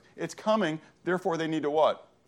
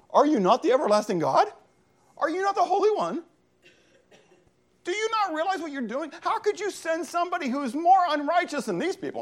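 very high-pitched, appalled questioning; incredulous protest; 4 dB louder than usual. Retold or imitated. Prophet challenging God over sending the Chaldeans, incredulous. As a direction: act out a scandalized prophet, voice way up high. Prophet's shocked response acted out.

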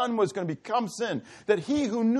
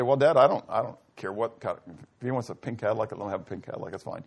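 offhand and fading much softer; casual Son; 9 semitones lower, 3 dB quieter than usual. Retold or imitated. The Son shrugging to the Father, a casual fading voice. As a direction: shrug it off, trailing away quietly.

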